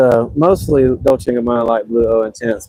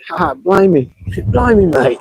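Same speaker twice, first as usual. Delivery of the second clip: high-pitched mock Australian, tickled by it; hammed-up Aussie accent, much higher; playful Australian accent; 6 semitones higher, slower than usual. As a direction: exclaim it in a jokey, amateur Australian accent. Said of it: "I can't really do an Australian accent".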